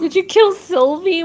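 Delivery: blurted, high and loud; loud accusation. Acted. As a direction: fire the question at him.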